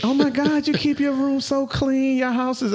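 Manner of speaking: high, slow gushing praise; gushing admirer voice. Retold or imitated. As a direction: play an impressed guest raving. Imitating people's gushing compliments.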